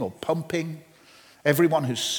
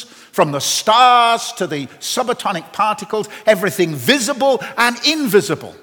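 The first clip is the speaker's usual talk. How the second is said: full-voiced preaching, louder, driving each line home; 4 semitones higher, 5 dB louder than usual. Preacher proclaiming to the congregation, full force preaching. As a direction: proclaim it from the pulpit.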